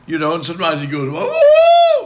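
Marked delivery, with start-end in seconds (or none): 1.1-2.0 very high, loud, sustained "whoa"; imitated singer's flip into falsetto; falsetto whoop. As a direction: hoot up into a ringing falsetto. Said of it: "you go into falsetto".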